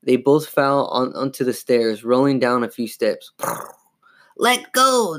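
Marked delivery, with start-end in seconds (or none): 4.4-5.2 very high, snarling dummy command; drawn-out, high-pitched demand; ordering. A narrator's reading. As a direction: order it like a furious puppet. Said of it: "the dummy ordered".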